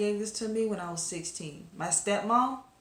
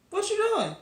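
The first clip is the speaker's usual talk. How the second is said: much higher, baffled "what you doing?"; incredulous squeak; 13 semitones higher, 4 dB louder than usual. Retold or imitated. Quincy catching Tora and Poppy blushing, much higher and baffled. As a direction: play it like a friend catching them.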